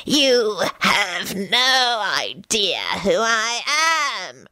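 slow, drawn-out menacing skull voice; eerie, threatening villain voice; deep threatening hiss. A narrator's reading. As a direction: hiss it slowly like a sinister talking skull. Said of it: "hissed a deep and threatening voice".

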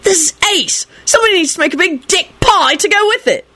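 put-on very high-pitched enthusiastic boy; excited child. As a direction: pipe up with childish delight. Boy delighted by the cake.

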